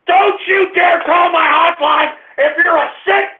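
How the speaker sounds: ordinary talk, nothing marked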